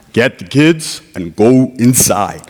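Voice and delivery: deep dad voice. Retold or imitated. Dad ordering his son to take the kids inside, slow deep command. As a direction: drop the voice and order it slowly. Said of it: "my dad had a deep voice".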